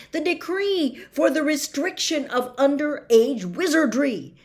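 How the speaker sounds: put-on boy insisting on his guilt; earnest Harry. A narrator's reading. Harry telling the Minister, earnestly.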